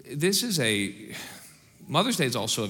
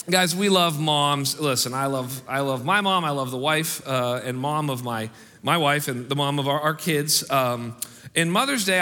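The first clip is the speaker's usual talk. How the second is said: ordinary delivery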